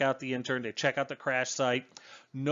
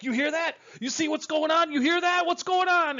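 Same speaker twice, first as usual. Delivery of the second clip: high, jittery, frightened voice, much higher; a spooked young intern hearing things; panicky intern. Retold or imitated. Intern freaking out to the crew, panicky and high. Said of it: "the intern starts tweaking".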